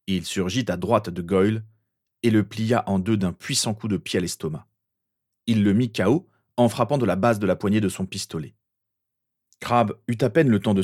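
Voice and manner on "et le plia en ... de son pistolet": plain reading voice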